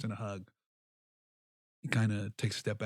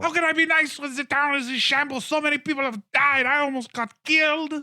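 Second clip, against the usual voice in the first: high, loud frantic scolding; stomping mayor berating Cappy; furious mayor; 9 dB louder than usual. Acted. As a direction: rant at him like a ruined politician. Mayor ranting.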